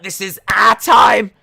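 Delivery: full-volume, high-pitched mock battle cry; imitated shout. Host imitating a wrestler yelling at the commentators, mock shouting. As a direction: bellow it slowly, drawing out the words. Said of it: "Why are they shouting".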